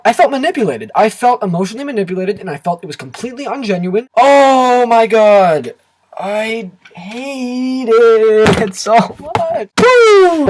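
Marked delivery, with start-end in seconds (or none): 4.2-9.7 slow, stretched words of pure exasperation; exasperated drawn-out rant. Real, not acted. Reviewer venting to viewers, slow and exasperated. Ranting about hated movie. 9.8-10.5 high loud whoop to let off steam; cathartic whoop. Real, not acted. Reviewer blowing off steam after ranting, loud high whoop. Releasing rant frustration.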